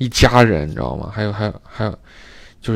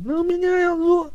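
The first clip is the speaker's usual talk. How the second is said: put-on coy girl voice. Mimicking the coy tenant.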